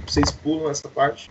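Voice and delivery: usual speaking voice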